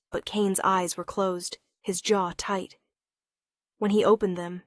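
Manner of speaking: usual speaking voice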